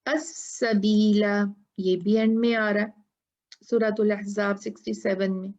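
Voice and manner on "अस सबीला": अस-सबीला recited slowly, the final alif held two counts; stopping at the verse end to show the long alif; recited with the stop. Shown as a demonstration. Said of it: "देखें यहाँ पर".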